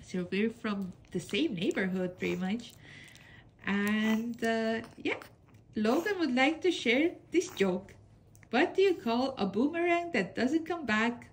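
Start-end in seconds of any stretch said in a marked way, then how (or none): none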